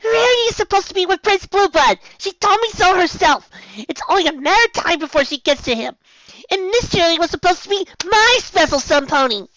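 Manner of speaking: high, loud upset little filly; whiny young filly. A narrator's reading. Sweetie Belle upset.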